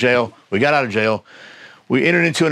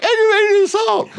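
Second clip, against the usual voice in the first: timid squeak; 4 dB louder than usual. Shown as a demonstration. Scared teen telling the biker his charge, laughing squeak. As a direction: squeak it out like a frightened kid. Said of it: "It probably sounded more like".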